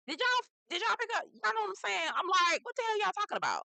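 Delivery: high-pitched, loud mock bafflement; incredulous outburst. Acts out baffled reaction to the show.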